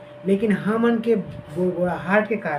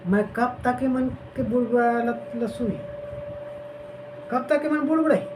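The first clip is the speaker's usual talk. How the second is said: higher, exasperated voice of God; 6 semitones higher than usual. Retold or imitated. Preacher voicing God tired of the grumblers, exasperated. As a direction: sound fed up with the grumbling people. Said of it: "क्या बोलते परमेश्वर".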